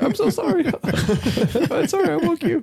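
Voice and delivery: very high, laughing imitation of pleading; mock meek apology. Man backtracking to partner he woke, high mock apology. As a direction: squeak out a hammed-up apology.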